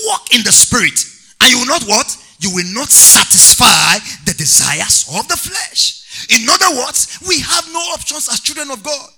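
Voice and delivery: loud, high-pitched sermon delivery; rousing pulpit cry to the congregation; full-force preaching. Real, not acted. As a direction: preach it loud, stretching the key words.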